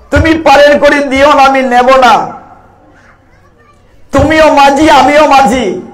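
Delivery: high, loud preaching plea; impassioned plea. Acted. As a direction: plead with Rama at full voice. Boatman pleads with Lord Rama.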